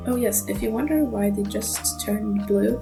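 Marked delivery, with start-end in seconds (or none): none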